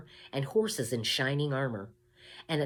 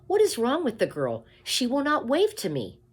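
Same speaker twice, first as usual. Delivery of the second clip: put-on higher, louder girlish call; princess calling papa; 9 semitones higher, 7 dB louder than usual. A narrator's reading. Princess calling to the king, high and louder. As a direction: call out to her father like a little princess. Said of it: "called the princess".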